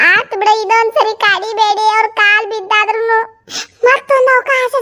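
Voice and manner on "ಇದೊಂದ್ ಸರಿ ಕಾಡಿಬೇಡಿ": ordinary talk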